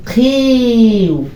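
"খিউ" very slow and high, with a puff on kh; Q pronunciation demo. Shown as a demonstration. Teacher introduces the next letter's sound, drawn-out aspirated demo. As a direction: stretch "খিউ" into a long aspirated glide. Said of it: "আমাদের যে উচ্চারণ রয়েছে".

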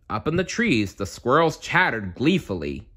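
usual speaking voice

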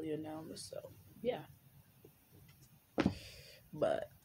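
normal talking voice

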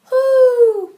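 high loud owl hoot. Acted. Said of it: "Owl tried a cock-a-doodle".

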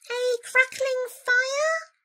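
slow, high, hopeful question; tentative mouse. A narrator's reading. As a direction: venture it in a tiny voice. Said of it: "ventured the Mouse".

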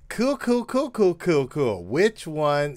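loud, high rapid-fire cools; hyped and bouncing; excited. Real, not acted. Excited reaction after the clip.

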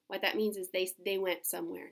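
ordinary delivery, nothing marked

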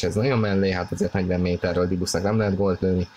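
ordinary delivery

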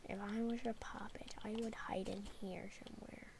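hushed whisper to himself. Real, not acted. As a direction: breathe it out with no voice. Sneaking about while searching.

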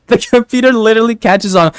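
words broken up by laughter; real laughter; laughing through words. Real, not acted. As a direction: talk while still laughing. Laughing while telling fire story.

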